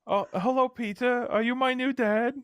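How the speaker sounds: put-on high childlike boy voice, asking sweetly; a small boy greeting his new dad; child voice of Milo. Milo voiced high, greeting Peter as his new dad.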